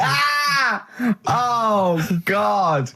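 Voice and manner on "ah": shocked cry. Real, not acted. Reacts to cat run over.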